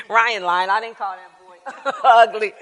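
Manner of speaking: laughing through a mock denial; giggling, playful protest. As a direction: laugh it off while denying it. Denying with a laugh.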